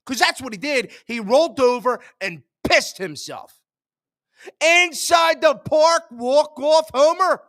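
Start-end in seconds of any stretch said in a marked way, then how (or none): 4.6-7.4 exasperated shout. Real, not acted. Fan raging at blown save.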